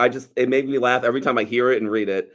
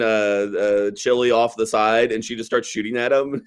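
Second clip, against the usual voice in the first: ordinary delivery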